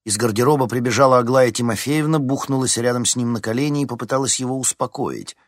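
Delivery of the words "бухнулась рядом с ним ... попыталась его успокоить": ordinary delivery, nothing marked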